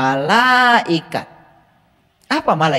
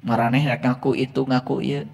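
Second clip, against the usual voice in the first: ordinary talk, nothing marked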